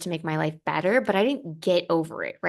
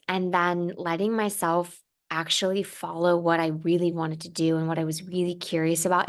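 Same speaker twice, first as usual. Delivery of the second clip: ordinary talk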